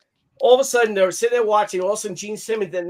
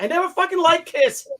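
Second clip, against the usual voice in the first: higher pitched huffy declaration; a hammed-up sulky protest; mock indignant; 10 semitones higher, 3 dB louder than usual. Husband telling his wife off about Kiss, mock huffy. Speaker's retold outburst to wife.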